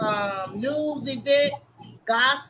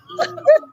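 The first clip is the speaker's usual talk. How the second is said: high cackling laugh; 9 dB louder than usual. Real, not acted. Laughing at own story.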